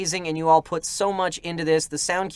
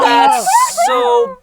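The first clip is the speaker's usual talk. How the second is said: excited squeal; 11 dB louder than usual. Real, not acted. Overwhelmed by donation message.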